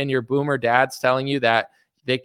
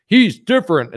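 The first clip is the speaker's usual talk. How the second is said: mocking quote; 7 semitones higher, 8 dB louder than usual. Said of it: "It's like".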